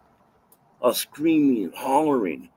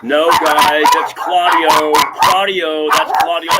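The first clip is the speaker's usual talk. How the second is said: loud, higher shout calling out to the guards; 4 semitones higher, 9 dB louder than usual. Retold or imitated. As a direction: yell over them that it's Claudio.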